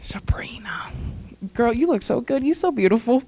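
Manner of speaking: hushed, whispered name, choked up; fighting tears. Real, not acted. Speaker turning to Sabrina while trying not to cry, tearful whisper. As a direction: breathe the name out, holding back crying. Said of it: "working so hard at it".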